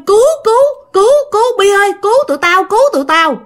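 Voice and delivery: retold desperate cries, high and urgent; cries for help. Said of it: "mà kêu".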